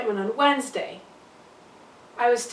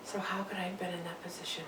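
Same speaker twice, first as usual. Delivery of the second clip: much softer, almost whispered; hushed self-questioning; 4 semitones lower, 13 dB quieter, faster than usual. Acted. Birth mother questions herself over her pregnancy, hushed. Guilty monologue, asking herself.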